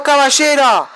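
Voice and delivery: normal talking voice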